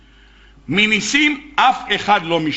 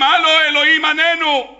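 mock shouting to god; 6 semitones higher than usual. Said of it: "צועקים".

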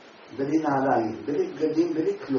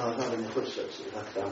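timid request; 5 semitones lower, 5 dB quieter, faster than usual. Retold or imitated. Timid man asks the tzaddik.

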